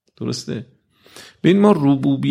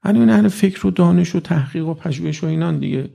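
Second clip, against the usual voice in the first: ordinary delivery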